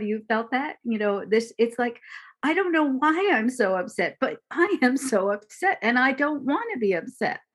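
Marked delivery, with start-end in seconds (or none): 2.4-7.4 higher and louder, a flustered complaint; frustrated upset. Retold or imitated. Teacher acting the upset self for listeners, flustered and higher. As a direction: let it spill out, upset and helpless.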